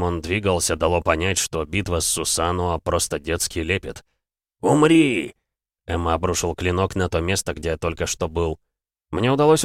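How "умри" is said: громкий боевой выкрик; выкрикнуть, нанося удар; яростный крик. Acted. Бог атакует героя. (яростный крик)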